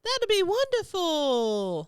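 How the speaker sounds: high, slow, loud mock gush; over-the-top sweet reply; hammed-up delight. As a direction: gush it like a fake game show host.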